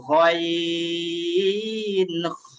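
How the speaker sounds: "গইন খ" very drawn out from back of throat; throat-end letters demo. Shown as a demonstration. Teacher names the two deepest letters, stretched pronunciation demo. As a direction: hold "গইন" long, then rasped "খ". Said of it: "কোন দুইটা হরফ উচ্চারণ হবে".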